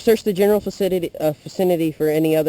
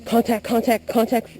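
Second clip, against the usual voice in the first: raised, higher alarm call; urgent callout; 5 semitones higher than usual. Real, not acted. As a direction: shout the alert. Calling enemy contact in game.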